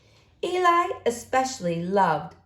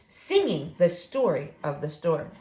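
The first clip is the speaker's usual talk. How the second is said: usual speaking voice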